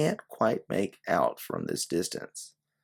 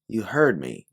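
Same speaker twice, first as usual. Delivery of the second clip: hard tone; 5 semitones higher, 5 dB louder than usual. Acted. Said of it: "you switch your tone and face her".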